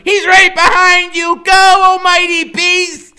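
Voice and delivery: high, loud urgent shout, slowing on the last words; frantic yelling. Acted. Freak yelling to his friend that the killer is close, high loud shout. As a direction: scream the warning at full voice. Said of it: "Freak is yelling".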